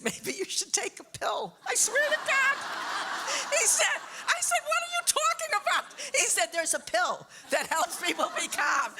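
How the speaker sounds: very high, loud, laughing squeals; cracking up in disbelief. Real, not acted. Retelling the therapist's pill advice, laughing in disbelief.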